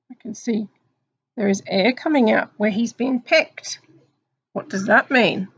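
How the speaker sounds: usual speaking voice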